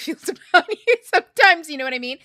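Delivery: laughing through the words; real laugh breaking up the line. Real, not acted. Laughing while teasing the listener about their soul.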